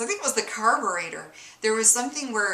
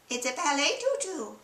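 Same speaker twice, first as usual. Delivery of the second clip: a higher, softer meek wife voice; 6 semitones higher, 4 dB quieter than usual. Acted. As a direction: answer timidly as the cartoon wife.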